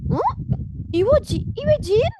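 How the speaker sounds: loud, high startled questioning; hammed-up shock, sharply rising; disbelief. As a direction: stumble over it in amazement. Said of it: "Kayak nggak nyangka gitu ya".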